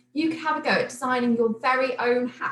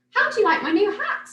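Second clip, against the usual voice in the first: put-on high, louder child's voice; a proud little girl showing off, much higher; excited child, louder; Millie's voice; 11 semitones higher, 6 dB louder than usual. A narrator's reading. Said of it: "she asked".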